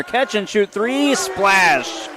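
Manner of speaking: high, rising shout at the made three; excited call. Real, not acted. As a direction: call the splash like the crowd just erupted. Three-pointer call in play-by-play.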